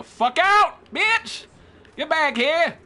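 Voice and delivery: high, loud trash-talk yell; loud gamer taunt, shooting up high; shouted taunt. Real, not acted. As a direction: yell after someone running away. Yelling at a fleeing opponent.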